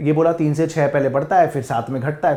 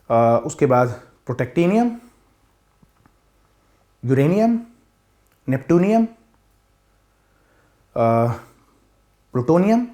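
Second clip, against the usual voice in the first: one name at a time, long pauses; each name drawn out with a rising end; slow, halting recall; 3 semitones higher, much slower than usual. Real, not acted. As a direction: dredge each name from memory. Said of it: "slowly बोलूँगा".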